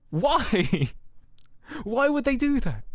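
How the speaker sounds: high, laughing exasperated why; laughing disbelief. Real, not acted. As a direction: laugh through the question in disbelief.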